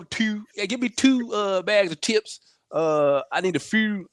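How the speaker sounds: mock Baltimore accent, pitched high. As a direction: order chips in a hammed-up Baltimore voice. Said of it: "you can't do Baltimore accent".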